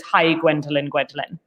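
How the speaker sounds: normal talking voice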